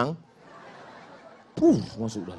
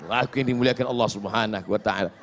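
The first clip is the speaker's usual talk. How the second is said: ordinary talk, nothing marked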